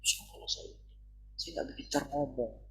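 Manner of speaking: soft, half-whispered mock self-doubt. Preacher mimics the devil's discouraging thoughts, hushed and self-doubting. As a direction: drop to a small, hushed voice of insecurity. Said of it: "Jangan dengarkan iblis".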